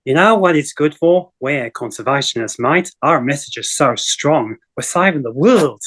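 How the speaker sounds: lightly put-on broad Aussie accent; a hint of Steve Irwin's Aussie accent. Shown as a demonstration. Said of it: "I can put on a broad Aussie accent".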